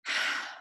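voiceless sigh out through the mouth; audible exhale. Shown as a demonstration. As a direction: breathe out audibly, releasing tension. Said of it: "breathe audibly out your mouth".

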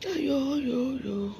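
high, soft "ah yo yo" lament; drawn-out wail. Real, not acted. Streamer bemoaning her reported page, drawn-out wail. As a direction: stretch the vowels into a sighing wail. Mock lament during livestream rant.

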